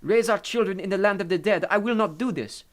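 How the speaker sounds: put-on high, loud, indignant Italian accent. A narrator's reading. Maria refuses to move her children, Italian accent. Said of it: "she spoke with an Italian accent".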